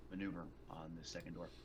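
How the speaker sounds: ordinary talk, nothing marked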